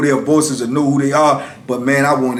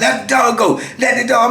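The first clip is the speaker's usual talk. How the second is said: high-pitched imitated command; retold officers' demand, pitched up; guards' urgent order; 10 semitones higher than usual. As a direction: call out urgently in a scuffle. Guards order him during struggle.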